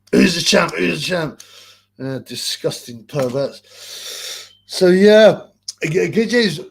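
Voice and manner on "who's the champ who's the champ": loud jeering chant; mock taunting shout. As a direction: bellow it like gloating over a beaten man. Voicing Ben taunting.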